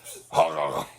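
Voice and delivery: sudden loud laugh; big laughing burst. Real, not acted. Friend cracks up at the moan, loud laugh.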